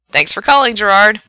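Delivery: loud, lifted on-air thanks; radio DJ sign-off. Acted. Frank thanks his caller on air, loud DJ voice. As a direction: send the caller off with energy.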